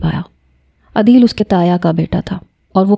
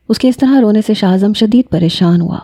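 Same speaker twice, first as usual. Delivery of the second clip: ordinary delivery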